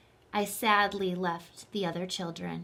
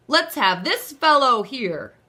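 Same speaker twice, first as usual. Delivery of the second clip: a lightly voiced jolly Santa, louder; booming but friendly, picking out the boy; Santa's voice; 9 dB louder than usual. A narrator's reading.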